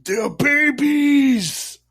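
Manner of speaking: hammed-up high, drawn-out yell; loud, stretched exasperated mock shout; Shredder yelling. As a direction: bellow it slowly in mock villain outrage. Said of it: "And Shredder just yells".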